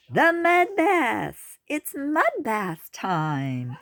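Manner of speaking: high, loud and slow, gleefully calling out; excited sows. A narrator's reading. Sows cheer the turtle into mud bath time, high and drawn out. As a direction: squeal it out like delighted pigs.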